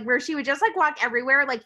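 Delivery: ordinary talk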